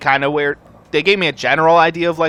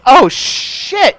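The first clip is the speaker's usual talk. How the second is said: panicked; 12 semitones higher, 6 dB louder than usual. Retold or imitated. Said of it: "freaking the fuck out".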